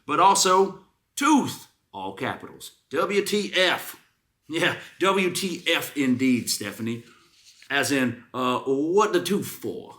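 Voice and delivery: review read out slow and high, with mock outrage; milk every word of the complaint; dramatic review reading. Host reading a customer review to her co-host, mock outrage.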